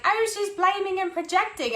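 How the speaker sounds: high, snide mock of critics. Imitating imagined critics in a high, sneering voice. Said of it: "people would be like".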